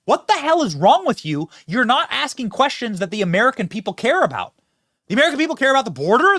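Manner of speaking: high, loud mock rant at reporters; Dean Phillips rant. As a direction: imitate a politician losing it, up high. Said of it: "being like".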